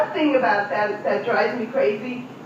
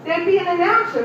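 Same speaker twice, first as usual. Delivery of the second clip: ordinary delivery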